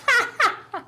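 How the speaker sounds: high wicked cackle; evil cackle. A narrator's reading. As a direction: cackle like a demon woman. Demon woman laughs after threat.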